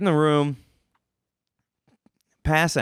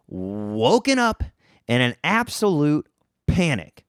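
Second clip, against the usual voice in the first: normal talking voice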